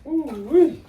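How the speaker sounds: high excited whoop. Real, not acted. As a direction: whoop at the big snake. Sees big snake.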